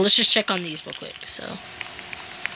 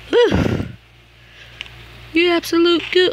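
exasperated yell; 9 semitones higher, 4 dB louder than usual. Real, not acted. Yelling at game.

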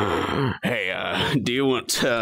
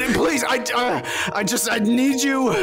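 desperate pleading; 11 semitones higher than usual. Acted.

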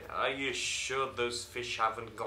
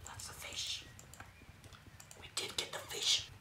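sneaky whisper; 7 dB quieter than usual. Pretend. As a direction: whisper like you're stealing something. Sneaking to steal the fish.

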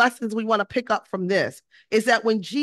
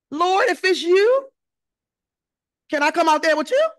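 loud, high call across the water; 8 semitones higher, 8 dB louder than usual. Acted. Peter calls to Jesus from the boat, loud hopeful calling out. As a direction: call up and out to someone far off.

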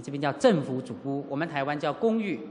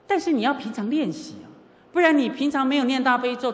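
ordinary talk, nothing marked

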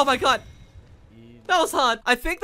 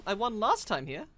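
usual speaking voice

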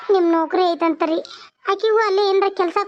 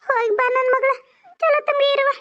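high, tearful mother's blessing; tearful farewell; 7 semitones higher than usual. Acted. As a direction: send a daughter off in a high weepy voice. Mother tearfully sees daughter off. (ಎತ್ತರದ ಅಳು ದನಿ)

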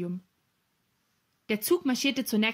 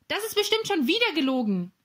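high-pitched indignant exclamation; calling out; 7 semitones higher, 3 dB louder than usual. A narrator's reading. Professor about the thief's answer, calling out. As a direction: call it out in a high voice. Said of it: "rief der Professor".

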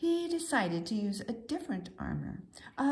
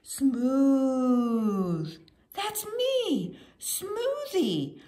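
delighted; 3 semitones higher, 5 dB louder, much slower than usual. Acted. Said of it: "and then he said".

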